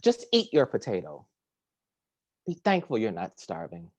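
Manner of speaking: normal talking voice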